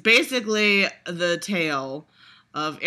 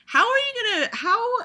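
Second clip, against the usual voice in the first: exasperated squeak; 3 dB louder than usual. Real, not acted.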